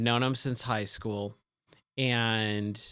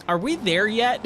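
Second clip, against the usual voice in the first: mock road-trip pestering, pitched high; lightly acted impatient question; impatient passenger; 8 semitones higher, 3 dB louder than usual. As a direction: ask it high, like a restless passenger.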